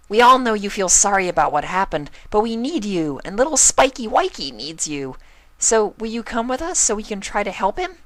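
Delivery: very high, loud, bouncy party pony; bubbly Pinkie. Acted. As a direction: gush at her like an eager hugging friend.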